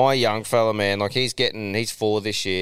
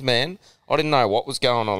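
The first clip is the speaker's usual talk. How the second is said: ordinary talk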